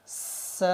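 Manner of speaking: س as a sharp, hissing whistle, drawn out very slowly; whistled س error; whistling s. Shown as a demonstration. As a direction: hiss the س like a whistle. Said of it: "آواز کو تیزی سے نکالتے ہیں".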